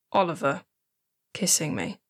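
usual speaking voice

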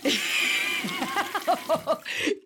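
a squeaky burst of laughter; high giggle. Acted. Fairy laughs at the toilet flush.

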